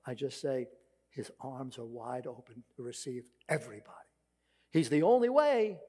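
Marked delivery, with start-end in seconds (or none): 1.1-3.9 hushed, breathy whisper. Real, not acted. Tender aside at the cross. 4.7-5.8 high, loud declaration; proclaiming loudly. Real, not acted. Proclaims after whispered line.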